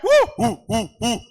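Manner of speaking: high loud whoops; whooping cheer. Real, not acted. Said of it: "소리 질러 주님께". (높고 크게 환호하는 우)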